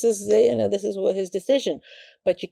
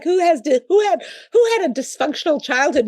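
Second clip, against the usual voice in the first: laughing question; 11 semitones higher, 7 dB louder than usual. Retold or imitated. Retelling lecture, audience laughing.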